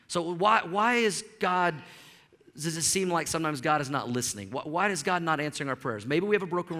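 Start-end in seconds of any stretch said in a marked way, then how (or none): none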